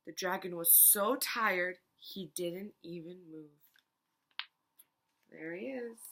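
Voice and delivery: ordinary delivery, nothing marked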